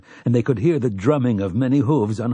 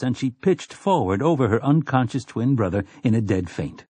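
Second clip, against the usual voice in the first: ordinary delivery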